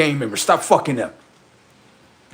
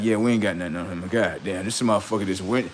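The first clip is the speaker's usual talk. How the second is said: quick, hushed mock rival voice; lightly voiced defeated gang; 8 semitones lower, 7 dB quieter than usual. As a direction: mutter it fast like the beaten crew. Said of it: "They like".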